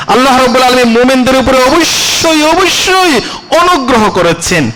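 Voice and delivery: high-pitched, emphatic declaration; impassioned preaching. Real, not acted. Preacher assuring believers of Allah's favour, impassioned and high. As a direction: lift the voice and stretch the key words.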